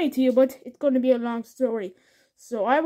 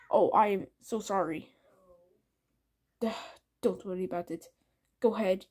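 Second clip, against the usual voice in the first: ordinary talk, nothing marked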